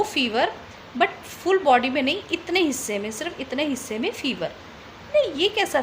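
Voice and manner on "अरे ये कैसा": a sudden high, startled अरे; surprised. Retold or imitated. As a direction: jump up in pitch, caught off guard. Shocked by strange fever. (चौंक कर ऊँची आवाज़ में अरे)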